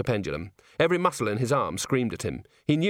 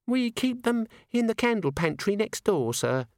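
put-on high young maid voice; 11 semitones higher than usual. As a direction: voice the nervous maid, higher.

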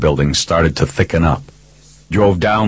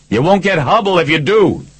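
high-pitched yelled plea; 12 semitones higher than usual. A narrator's reading. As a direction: yell it across to an armed man, pitched way up. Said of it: "I yelled".

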